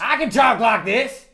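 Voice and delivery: slow, loud TV-lawyer holler; Jim Adler persona. Pretend. Loud lawyer showing off his voice, hollering. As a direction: belt it out high, word by word. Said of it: "that's just his, his persona".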